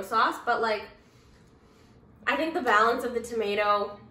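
talking between bites; chewing while talking. Real, not acted. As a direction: mouth partly full. Seen 1: Bit pretzel just before; closed-mouth chewing at 1.5–1.8 s, snack in hand.

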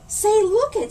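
high, loud call to look; high loud excited call. Acted. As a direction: point it out with sudden glee. Excited shout at the drooping daisy.

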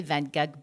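"Van Gogh" as French van-GOG, hard final G; French pronunciation. Shown as a demonstration. Speaker defending the easier choice, French pronunciation. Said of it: "that's the French pronunciation".

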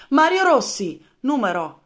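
barked roll-call announcement; loud call-out. Acted. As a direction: call it out like a soldier. Soldier calls out at roll call.

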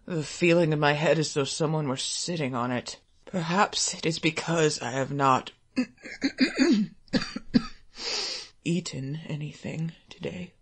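low, soft mutter; a weary father muttering. Acted. Ill father muttering to himself.

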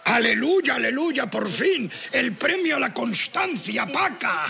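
high, exultant exclamations; jubilant celebration. Acted. Husband celebrates Paca's bingo win, jubilant. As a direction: proclaim it like a long-awaited miracle.

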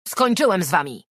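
loud, high angry shout; furious suspect shouting he is done. Acted. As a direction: yell it as he rips off the cables. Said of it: "krzyknął".

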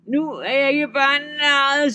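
high, loud troll growl of rage; bare a tusk and spit it out; furious troll. A narrator's reading. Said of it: "hugtand kom frem".

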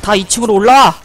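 loud call upstairs. Real, not acted. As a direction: shout it up to the partner. Calling teammate upstairs. (동료를 위층으로 부르는 외침)

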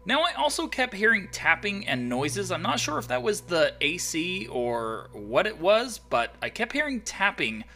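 loud, high, hyped recap; excited storytelling. Acted. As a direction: tell it big and breathless.

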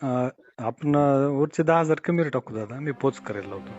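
thin, distant phone line voice; over a landline phone. Real, not acted. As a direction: voice coming through a phone receiver. Farmer speaking on phone call.

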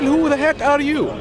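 usual speaking voice

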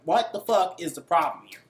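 ordinary delivery

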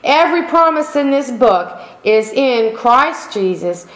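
ordinary talk, nothing marked

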